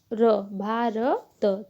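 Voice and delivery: slow, split into syllables भा र त; each syllable of Bharat spoken apart. Shown as a demonstration. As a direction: spell out भा र त slowly for the class. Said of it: "भ में आ की मात्रा तो भा".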